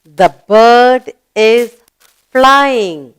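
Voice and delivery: very slow, drawn out for pupils to finish; slow prompting. Shown as a demonstration. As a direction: stretch it so the children join in. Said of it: "What is the bird doing?".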